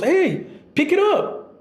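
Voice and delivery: slow, high, calm "pick it up"; even-toned dad correcting a kid; calm dad telling off. Retold or imitated. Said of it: "He's not even mad".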